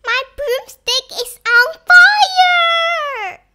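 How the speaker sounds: high, loud wail of alarm; storybook witch in loud, high-pitched dismay; panicked cry. A narrator's reading. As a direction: cry out in panic, drawing out the last word. Winnie's broomstick is burning.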